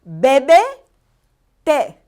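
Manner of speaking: Bebe loud and rising, té falling after a long pause; deliberate teacher model; slow Spanish drill. Shown as a demonstration. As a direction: model bebe té for the class to repeat. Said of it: "One more time".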